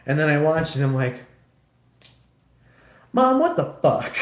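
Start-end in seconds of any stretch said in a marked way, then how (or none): 3.1-4.1 much higher, louder shocked yell; mock outraged yell. Son yelling at mom after seeing the volleyball scene. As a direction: shout it at mom in mock horror. Outburst at mom over the movie.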